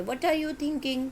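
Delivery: high, slow asking tune; question intonation. Shown as a demonstration. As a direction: ask it, rising like a real question. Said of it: "सवाल हम करेंगे". (सवाल के लहजे में, ऊँची आवाज़ में)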